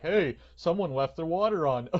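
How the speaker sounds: loud, laughing imitation of a jokester; joking. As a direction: crack the joke and guffaw at it. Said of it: "they're making jokes".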